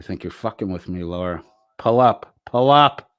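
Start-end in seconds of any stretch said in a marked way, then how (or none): 1.8-3.1 a louder, half-joking callback yell; mock shout. To Laura, a half-joking, louder mock shout. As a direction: throw the challenge back playfully. Echoing the all-caps challenge.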